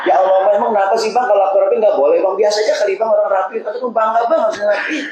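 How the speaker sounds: high, fast complaining wife's voice, half laughing; a wife's long, whining answer to one short question; whiny wife. Acted. Preacher voices the same wife again.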